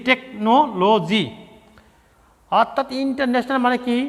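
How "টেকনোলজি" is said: slow. Shown as a demonstration. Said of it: "ভেঙে ভেঙে বানান করতে হবে।".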